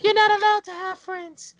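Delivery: high mock clingy girlfriend voice; squeaky possessive girlfriend voice. Mocking the controlling girlfriend.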